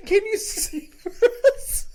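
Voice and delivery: laughing mock request. Retelling partygoers asking.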